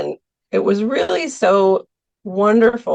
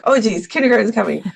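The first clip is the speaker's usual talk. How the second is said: quick, higher mock panic; mock fluster; 4 semitones higher, faster than usual. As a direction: play a teacher caught off guard, hurrying. Acting teacher's rush before class.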